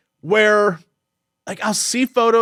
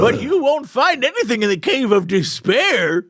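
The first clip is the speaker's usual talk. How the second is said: put-on higher creaky old-timer voice; old grunty man; 4 semitones higher than usual. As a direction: grumble like a cranky old hermit. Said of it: "what was that voice?".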